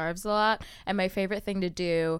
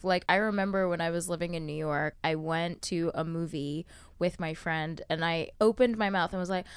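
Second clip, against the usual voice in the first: ordinary talk, nothing marked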